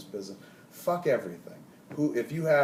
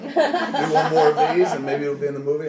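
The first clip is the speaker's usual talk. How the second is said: laughing; 4 semitones higher, 11 dB louder than usual. Real, not acted. Laughing while acting out directing an actor. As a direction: chuckle out the note to the actor.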